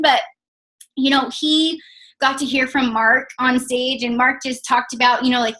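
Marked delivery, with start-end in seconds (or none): none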